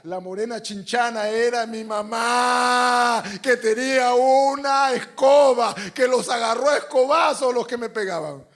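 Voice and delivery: loud, high, stretched-out proclamation; full-force preacher's climax; triumphant preaching shout. Real, not acted. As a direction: roar it to the congregation. Preacher climaxes the broom story.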